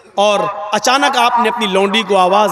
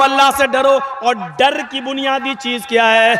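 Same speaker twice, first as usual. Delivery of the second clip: normal talking voice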